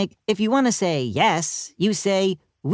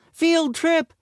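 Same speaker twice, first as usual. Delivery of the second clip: excited yell; 13 semitones higher than usual. A narrator's reading. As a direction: cheer like a thrilled pupil. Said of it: "yelled Ryan".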